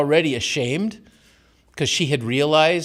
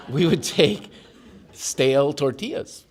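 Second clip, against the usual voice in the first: ordinary talk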